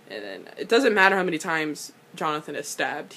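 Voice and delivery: ordinary talk, nothing marked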